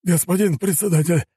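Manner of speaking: high-pitched urgent blurt; winded general blurting it out; out of breath. A narrator's reading. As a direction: burst out with urgent news, high. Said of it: "едва переводя дыхание, выпалил он".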